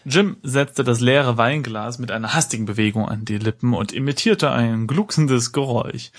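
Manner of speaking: normal talking voice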